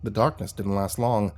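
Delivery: plain reading voice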